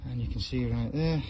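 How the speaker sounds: ordinary talk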